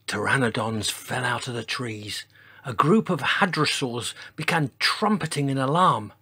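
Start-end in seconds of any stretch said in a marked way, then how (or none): none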